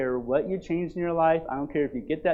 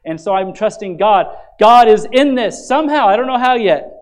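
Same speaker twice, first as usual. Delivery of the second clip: louder and louder, driving conviction home; preaching at full force; 5 semitones higher, 9 dB louder than usual. Acted. Pastor urging the church to trust God, full-force preaching. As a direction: preach it to the congregation.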